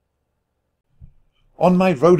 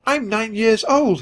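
put-on high, childlike protest; squeaky, emphatic little mouse voice; insistent little nephew; 9 semitones higher than usual. A narrator's reading. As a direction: insist it like an affronted small child. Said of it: "he insisted".

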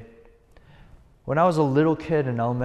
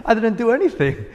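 higher and louder, laughing through it; laughing little kid; 9 semitones higher, 6 dB louder than usual. Retold or imitated. Pastor as a child protesting to his dad, laughing. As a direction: giggle it like a puzzled child. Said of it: "I was like".

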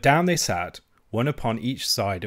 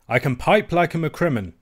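higher-pitched, mildly raised boast; boastful cry; 6 semitones higher, 4 dB louder than usual. A narrator's reading. Piper Robin boasting of his skill to his rival, mild cry. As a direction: cry it out as a proud claim. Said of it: "cries Robin".

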